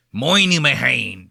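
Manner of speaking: "Moynihan" in an exaggerated Boston accent; mock the Boston way of saying "Moynihan"; broad Boston "MOY-nuh-hay-un" for Minihane. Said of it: "Don't give me that Boston Moynihan shit".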